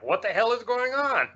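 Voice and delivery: high-pitched, incredulous retold outburst; baffled exclamation. Camper sees deer wander in during hunting season, incredulous. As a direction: exclaim it in amused disbelief.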